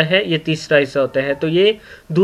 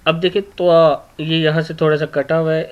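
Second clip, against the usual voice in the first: normal talking voice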